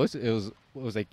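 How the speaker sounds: ordinary talk, nothing marked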